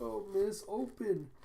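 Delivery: a high, small pleading voice; high little voice. Retold or imitated. A small creature urging miss to open: a high pleading voice.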